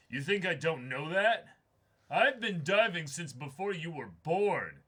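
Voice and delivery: put-on loud indignant old-timer, rising fury; angry old diver. Grizzled diver yelling at the young guide, angry.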